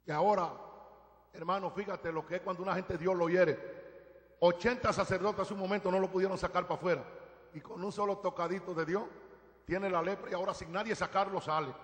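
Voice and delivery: ordinary delivery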